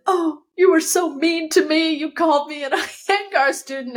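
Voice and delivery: high, whiny imitation of crying; mock tearful complaint. Crying student confronts her teacher on stairs, mock tearful whine. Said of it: "why crying?".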